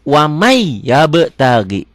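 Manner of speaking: "Wamai yabtagi" slow, long doubled Y; merged recitation. Shown as a demonstration. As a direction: melt the N of "Wamai" into a held Y. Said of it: "Jadi wamai masuk ke".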